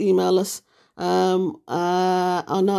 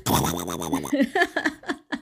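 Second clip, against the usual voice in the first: imitated snoring noise; 5 dB quieter than usual. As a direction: snort like a sleeping snorer. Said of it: "all she heard was".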